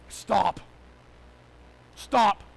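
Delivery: short, loud falling "Stop"; loud command. Real, not acted. Pastor halting wayward congregation, loud command. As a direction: command it, pause, command harder. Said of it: "put in your boat to yell at you".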